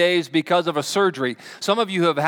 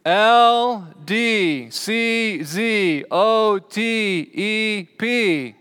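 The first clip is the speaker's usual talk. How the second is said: slow, raised, drawn-out letters; calling out letters; 5 semitones higher, much slower than usual. Shown as a demonstration. Preacher testing the congregation's eyes on a chart, calling out slowly. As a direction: call each letter out separately, high and long.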